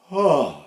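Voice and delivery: relaxed sigh demo; deep sigh. Shown as a demonstration. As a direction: breathe a sigh out down low. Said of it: "now I'm gonna move my sigh point".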